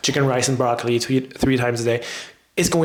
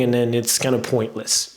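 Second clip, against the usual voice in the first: usual speaking voice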